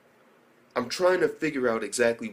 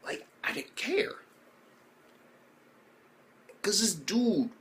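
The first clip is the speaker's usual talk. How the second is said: excited whisper; 9 semitones higher than usual. Real, not acted.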